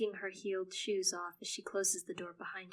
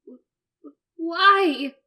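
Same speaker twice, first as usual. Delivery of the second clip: very high, loud stammered scream; screamed sob; 9 semitones higher, 18 dB louder, slower than usual. Acted. Son screaming at his mother, sobbing. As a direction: scream the why through sobs. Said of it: "I scream".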